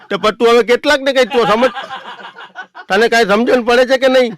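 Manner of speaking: very high, loud fed-up yelling; furious exasperation. Retold or imitated. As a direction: beg him at full volume to drop it. Passengers yell, fed up.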